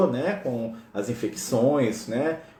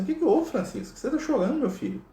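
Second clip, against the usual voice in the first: much higher-pitched gentle question; concerned tenderness; 12 semitones higher than usual. Retold or imitated. Jesus finding Francis crying, tenderly concerned. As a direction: ask like a concerned father to his child.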